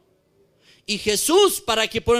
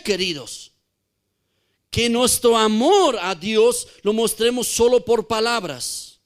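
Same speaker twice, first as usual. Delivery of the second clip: ordinary talk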